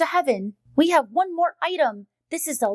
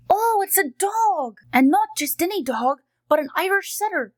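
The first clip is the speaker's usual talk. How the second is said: ordinary delivery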